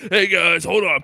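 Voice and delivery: loud, fast frat bro cop; hammed-up party cop barging in; bro cop character. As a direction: burst in as a hyped party cop. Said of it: "Chet with law enforcement".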